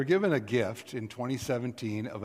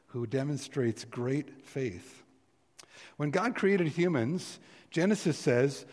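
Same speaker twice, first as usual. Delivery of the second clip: normal talking voice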